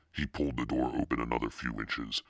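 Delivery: plain reading voice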